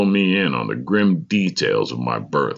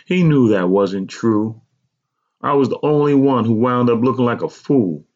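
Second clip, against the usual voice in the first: higher, aggrieved mother's lament; 6 semitones higher, 4 dB louder than usual. A narrator's reading. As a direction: lament the wrong in a raised pitch. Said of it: "my mother lamented".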